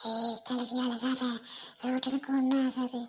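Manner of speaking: very low, slow voice; consoling figure. Acted. Friend telling the old woman not to cry, low consoling. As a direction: drop the pitch deep and reassure slowly.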